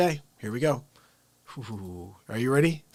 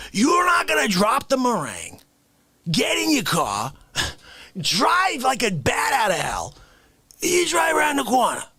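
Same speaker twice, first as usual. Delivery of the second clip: frantic shout; 7 semitones higher than usual. Acted. Frantic comic rant.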